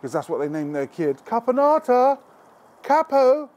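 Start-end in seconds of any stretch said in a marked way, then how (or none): 1.3-3.5 much higher, louder posh call across a park; posh woman calling her kid. Retold or imitated. Mock posh mother calling her kid across the park. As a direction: shout it like a posh mum summoning her child. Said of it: "something a posh woman would shout in a park".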